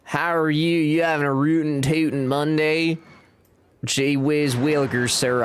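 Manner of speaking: a hint of a goofy Southern cowboy greeting; shitty Southern accent. Streamer greets chat in a lightly put-on Southern accent. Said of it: "when you do that voice".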